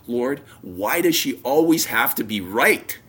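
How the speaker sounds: loud exasperated cry to God, retold; angry outburst. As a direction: re-enact a furious complaint at full volume. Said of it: "Now I'm really mad".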